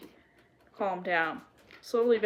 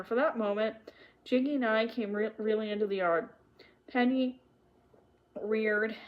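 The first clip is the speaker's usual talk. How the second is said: usual speaking voice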